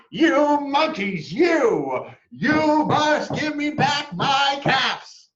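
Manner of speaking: very high, loud, slow shouting; furious shout. A narrator's reading. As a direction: stamp both feet and roar. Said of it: "stamped both his feet and shouted".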